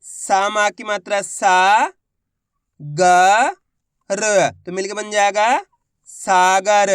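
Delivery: slow sounding-out, सा stretched long; syllable-by-syllable demo. Shown as a demonstration. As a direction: say ग and र apart, then blend सागर. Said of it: "एक बार फिर से देखिए".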